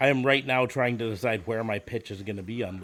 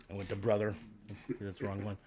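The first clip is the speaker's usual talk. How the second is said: ordinary delivery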